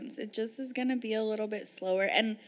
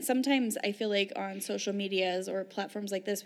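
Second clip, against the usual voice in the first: ordinary talk, nothing marked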